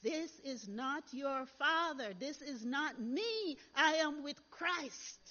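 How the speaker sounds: voice of God. Retold or imitated. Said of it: "it's like a voice said to me".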